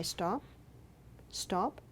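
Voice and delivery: quiet soothing repeats; soft soothing stop. Retold or imitated. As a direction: hush a crying baby gently. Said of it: "I say".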